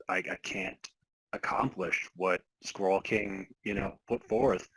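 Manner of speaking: normal talking voice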